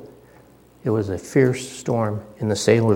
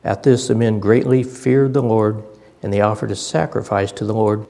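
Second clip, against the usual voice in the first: louder, ringing Bible reading from the pulpit; scripture reading; 7 dB louder than usual. Acted. Preacher reads the Jonah story aloud to his church, proclaiming loudly. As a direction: proclaim the passage to the whole room.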